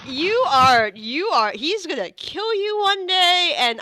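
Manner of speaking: loud, high-pitched playful outburst; mock exasperated scolding. Real, not acted. Game master warning a joking player, loudly exasperated.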